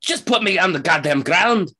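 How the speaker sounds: high, loud protesting character voice; indignant halfling. Acted. Halfling held by his shirt, indignantly protesting. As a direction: squawk in outrage at being held. Struggling halfling demands release.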